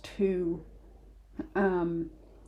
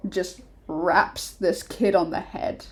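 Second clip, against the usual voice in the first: normal talking voice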